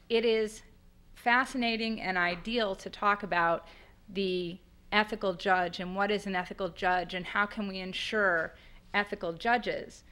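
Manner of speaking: ordinary delivery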